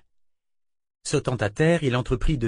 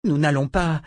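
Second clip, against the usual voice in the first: raised, tight-jawed refusal; through clenched jaws; 5 semitones higher, 4 dB louder than usual. A narrator's reading. Verna refusing to follow Richard, through clenched jaws. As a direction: refuse angrily, pitch pushed up. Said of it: "lâcha Verna entre ses mâchoires serrées".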